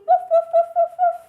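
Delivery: high, loud rapid woofs; yappy pretend dog noise; puppy barks. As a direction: bark like an eager puppy. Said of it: "lots of animal sounds in this song".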